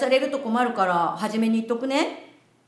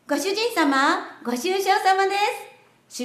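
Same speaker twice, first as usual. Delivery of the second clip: sugary maid cafe greeting; 8 semitones higher than usual. Pretend.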